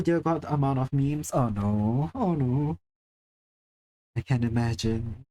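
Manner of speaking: ordinary talk, nothing marked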